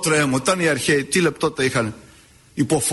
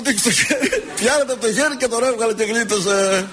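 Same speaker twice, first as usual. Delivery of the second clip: laughing; 4 semitones higher than usual. Real, not acted.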